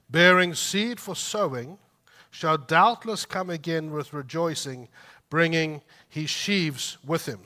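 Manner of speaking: loud, ringing psalm reading; scripture reading. Acted. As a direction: proclaim the psalm to the congregation. Reads Psalm aloud.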